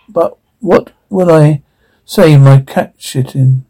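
ordinary talk, nothing marked

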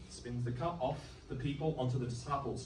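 ordinary talk, nothing marked

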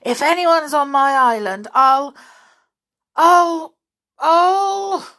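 anger building into a choked cry; sputtering rage, "I'll" stuck and rising; furious. Acted. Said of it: "looking very fierce and angry".

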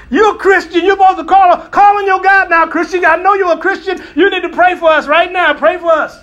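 loud, high, desperate demand; urgent shouting. Acted. Captain yelling at Jonah to pray, high and loud. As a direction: yell it over the storm. Captain pleading during storm.

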